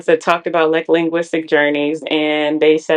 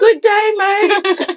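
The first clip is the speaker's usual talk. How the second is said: high, louder hammed-up British accent; mock British accent; 4 dB louder, much slower than usual. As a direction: greet like a cartoon Brit. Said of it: "It's the same accent".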